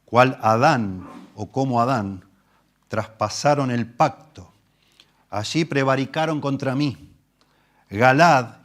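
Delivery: slow, weighty Bible reading; solemn scripture reading. Acted. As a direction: read the psalm with gravity. Reading the prophet aloud.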